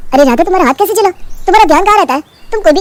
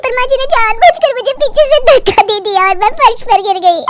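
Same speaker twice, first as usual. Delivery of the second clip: high, put-on wounded bahu voice; scheming daughter-in-law faking; 7 semitones higher than usual. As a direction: play the falsely hurt victim, pitched up. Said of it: "गिरने का नाटक करती है".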